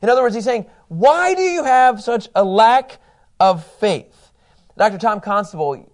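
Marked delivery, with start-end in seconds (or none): 0.9-4.3 high, loud, drawn-out challenge; exasperated rebuke. Acted. Preacher voicing Moses to doubting Israelites, exasperated rebuke. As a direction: demand it slowly, stretching the words out.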